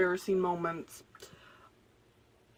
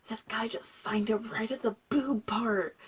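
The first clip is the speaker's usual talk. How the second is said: urgent whispered confession. Retold or imitated. Telling her friend about the signed shirt, whispering. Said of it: "it first started off as a whisper".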